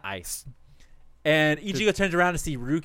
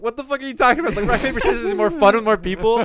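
higher, louder disbelieving yell; incredulous outburst; 6 semitones higher, 4 dB louder, faster than usual. Retold or imitated. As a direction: scoff at the nonsense, loud and high. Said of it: "And Ichigo is like".

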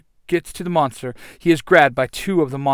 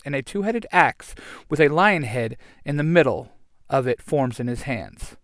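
ordinary delivery, nothing marked

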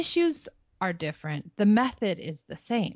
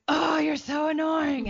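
imitating teen's groan; 8 semitones higher, slower than usual. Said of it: "She's like".

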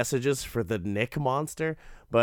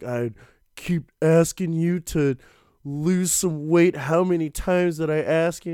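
mock nagging, higher and louder; nagging complaint; 5 semitones higher, 5 dB louder than usual. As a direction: scold him like an irritated wife, hammed up. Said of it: "she's just like".

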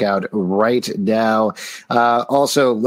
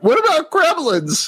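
high, cracking eager question; voice cracking fanboy. Retold or imitated. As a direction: blurt it out like an excited fan. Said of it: "your voice cracks when you ask them".

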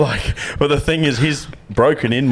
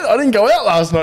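incredulous; 11 semitones higher, 4 dB louder than usual. Retold or imitated. Answers mate with disbelief.

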